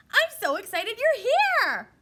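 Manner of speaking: high, loud, thrilled exclamation; excited sentence. Shown as a demonstration. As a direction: burst out with delight to show excitement. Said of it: "That's an excited sentence".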